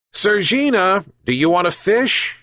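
high-pitched coaxing question to a cat; coaxing call. A narrator's reading. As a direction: lure the cat with an offer. Old man coaxes the cat.

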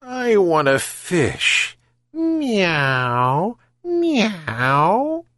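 slow, high mewing cat voice; phony-purring cat. A narrator's reading. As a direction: mew it sweetly insincere, drawn out. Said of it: "mewed around his legs with a phony purr".

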